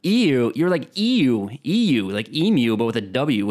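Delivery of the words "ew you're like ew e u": "Ew" said loud and high as a drawn-out ee-you. Shown as a demonstration. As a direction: stretch "Ew" into ee-yoo, louder. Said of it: "Say like O O O O O H".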